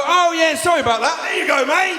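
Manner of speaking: very high, mock-breezy apology; imagined obliging wife. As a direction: hand it over with exaggerated, sing-song politeness. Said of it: "Did he expect my wife to go".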